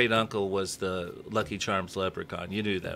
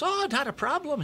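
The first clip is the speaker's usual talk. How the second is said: leprechaun voice. Acted. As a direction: pitch way up into a comic leprechaun. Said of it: "great leprechaun voice".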